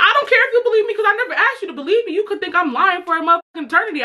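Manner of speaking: high, fast, through laughter; laughing retort. Retold or imitated. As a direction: laugh it off high and quick at his accusation.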